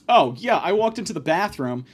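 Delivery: ordinary talk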